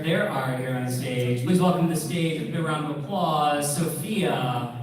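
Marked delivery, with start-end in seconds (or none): none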